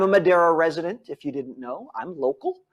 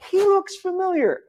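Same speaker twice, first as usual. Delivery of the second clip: recognizing voice; 13 semitones higher than usual. Retold or imitated. Viewers recognizing the host.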